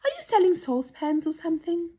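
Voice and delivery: high-pitched doll voice; dainty doll. Acted. Doll questions the shouting children, high and curious. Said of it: "asked a beautiful dressed doll".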